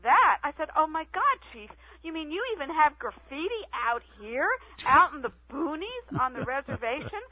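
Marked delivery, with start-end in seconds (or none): 0.0-0.4 high, loud startled "That?"; retold surprise. Retold own surprised reaction. 0.8-7.2 high, mock astonished question; retold amazement.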